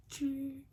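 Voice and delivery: high, soft metallic ching; high-pitched chain jingle; imitated chain ching. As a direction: mimic a chain lightly ringing. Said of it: "I hear the hammock get touched".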